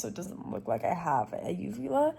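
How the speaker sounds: ordinary delivery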